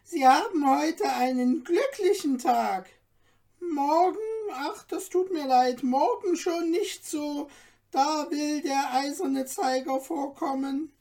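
lively little dwarf. A narrator's reading. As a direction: chatter on like an excitable dwarf. Dwarf reads Stefan's fortune.

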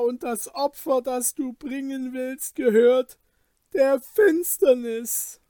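high, loud, impassioned appeal; anguished pleading. Acted. Kaspar fears for his friend's salvation, anguished plea. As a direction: cry out to save his soul. Kaspar begs Wilm to stop.

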